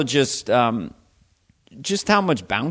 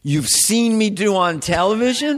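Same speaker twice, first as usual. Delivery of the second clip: mock incredulity; 6 semitones higher than usual. Comic teasing his audience about requests, incredulous. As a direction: get this straight, mock-baffled.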